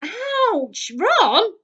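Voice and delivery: high, loud pained yelp; dad hurt in a crowded chimney. A narrator's reading. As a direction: cry out when jostled. Squashed in the chimney.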